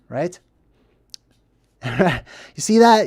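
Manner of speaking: ordinary talk, nothing marked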